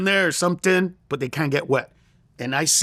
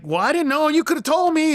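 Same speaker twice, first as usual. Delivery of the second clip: defensive protest; 9 semitones higher than usual. Retold or imitated. Defensive retort to brother, retold.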